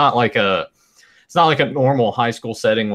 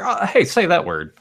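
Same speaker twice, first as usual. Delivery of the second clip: higher, mock-eager prompting; teasing request; 9 semitones higher than usual. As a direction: imitate someone coaxing her to perform. Mimics teasing request to Emily.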